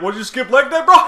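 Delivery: loud high bro voice; mock bro shout. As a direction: yell it like a gym bro. Mocking a guy at wheelchair.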